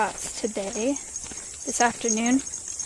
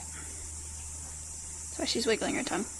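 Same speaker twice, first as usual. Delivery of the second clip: whispered aside; 12 dB quieter, slower than usual. Real, not acted. Aside to chat.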